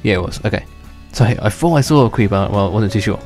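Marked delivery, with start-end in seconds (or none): none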